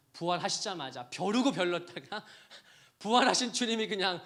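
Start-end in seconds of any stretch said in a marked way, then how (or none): 1.5-4.2 laughing. Real, not acted. Laughing at imagined scene.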